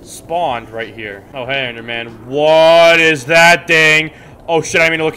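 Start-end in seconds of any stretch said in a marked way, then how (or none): 2.2-5.2 startled yell. Real, not acted. Gamer sees looming Enderman, startled loud cry. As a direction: shout it out in alarm.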